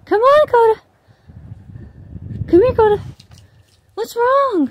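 very high, loud pet-talk voice; coaxing the dog. Real, not acted. Owner begs dog Coda to come, very high coaxing call. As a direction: pitch it way up to coax a worried dog over. Calling her dog over.

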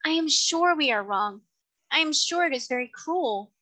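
put-on high, upset young girl pleading; beseeching girl. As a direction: plead that it is cruel. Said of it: "in a beseeching tone".

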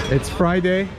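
ordinary delivery

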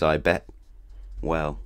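plain reading voice

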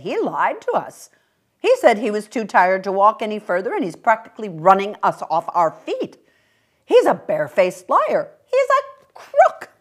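loud, outraged, rising to a high crook; indignant mother. Acted. Mother denouncing the little man to her daughter, loud and indignant. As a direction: spit out the accusation.

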